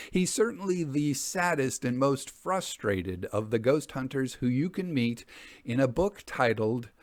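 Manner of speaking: ordinary delivery, nothing marked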